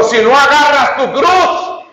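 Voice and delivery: sudden high-pitched stern shout; harsh demand. Acted. Christ sternly challenging would-be disciples, harsh shout. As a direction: bark it out as a hard command. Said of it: "me hablaste duro".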